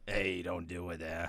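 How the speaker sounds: low hushed slow warning; soft low mock warning. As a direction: mutter it slowly. Joking echo of demon.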